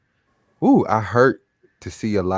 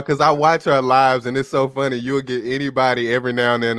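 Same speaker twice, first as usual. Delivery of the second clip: ordinary talk, nothing marked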